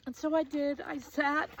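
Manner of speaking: high, choked-up telling; real tearfulness. Real, not acted. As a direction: keep going through welling tears. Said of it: "I'm gonna cry".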